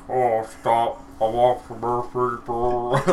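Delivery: slow, as if mouth full of food. Retold or imitated. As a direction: talk like a robot through a mouthful. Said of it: "he sounds like his mouth is full".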